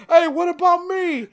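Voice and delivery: high, loud indignant gangster call; Penguin. Acted. Abandoned villain calling after Batman, high loud protest. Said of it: "Penguin's like".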